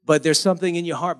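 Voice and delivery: ordinary delivery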